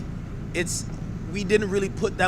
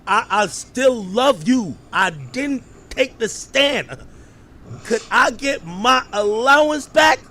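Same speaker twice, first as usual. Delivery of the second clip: slow, drawn-out pleading, louder toward the end; imitating Gina; 2 semitones higher, 4 dB louder, slower than usual. Mimicking a woman calling Puff to get back in, slow mock pleading. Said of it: "Yo, she's like this".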